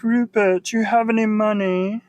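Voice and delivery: airy languid society voice; Andy Warhol voice. Retold or imitated.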